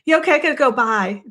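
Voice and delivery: quick, brusque sign-off; abrupt Boston goodbye. Retold or imitated. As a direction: rush the goodbye like you're hanging up. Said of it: "It's so Bostonian of me. I go".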